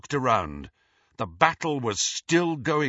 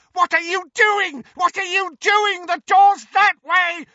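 frantic scream, much higher and louder; 7 dB louder than usual. A narrator's reading. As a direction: shriek in terror as Harry dives. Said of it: "screamed Malfoy".

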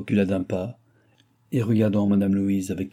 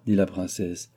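plain reading voice

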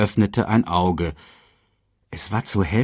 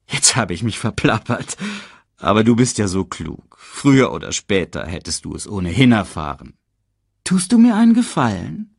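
lightly voiced scheming uncle, feigned confidentiality; sly lion uncle; 3 semitones higher, 4 dB louder than usual. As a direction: coax the cub with mock concern. Reads Scar feigning concern, manipulating Simba.

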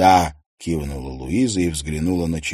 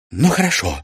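giving in; 11 semitones higher, 3 dB louder than usual. A narrator's reading. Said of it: "сдалась Ксения".

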